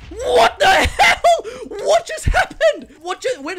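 high, loud, drawn-out shout of shock; stunned shout. Real, not acted. Gamer shocked by a sudden kill, stunned shout. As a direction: yell in disbelief.

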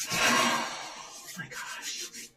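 slow, breath-only whisper of amazement; awed whisper. Real, not acted. Tarot reader overwhelmed by beautiful energy for Leo, awed whisper. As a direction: whisper it, stretched out in disbelief.

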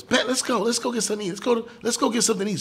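put-on a kid jumping at food; eager kid. Father voicing his son eager to go eat, excited. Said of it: "So Hunter goes".

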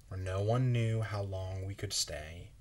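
usual speaking voice

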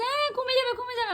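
high-pitched mock father, fretting; a light take on her father warning about the rice; imitating father. Daughter imitating father fussing over rice, high mock voice. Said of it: "বাবা বলছে".

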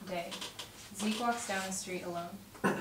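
ordinary talk

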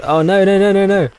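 high, loud panicked outburst; real alarm. Real, not acted. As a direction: blurt it out in sudden alarm. Sudden panic as game turns bad.

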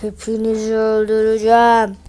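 menacing monster voice, low; ice golem. Acted. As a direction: threaten them as the lumbering golem.

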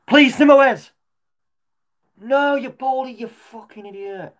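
high, loud furious shout at the screen; real frustrated yell. Real, not acted. Football fan shouting at a player on stream, furious yell.

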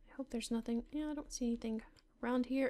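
ordinary talk, nothing marked

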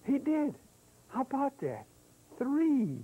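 amazed. Acted. Host cheers the Swami for the kids, high excited surprise. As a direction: act thrilled the frog got it right.

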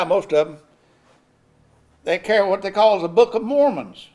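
ordinary talk

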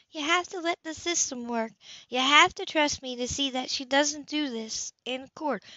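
Anna calming Cam, urgent plea; Anna urging. A narrator's reading. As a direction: press him to trust her.

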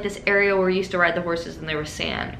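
normal talking voice

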